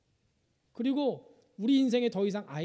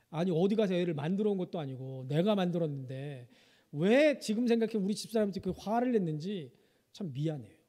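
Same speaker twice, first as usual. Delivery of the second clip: normal talking voice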